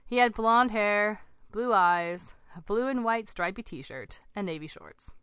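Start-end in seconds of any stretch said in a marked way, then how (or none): none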